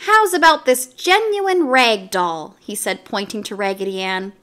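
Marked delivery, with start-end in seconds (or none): 0.1-2.6 salesy barker. A narrator's reading. Mario pitches.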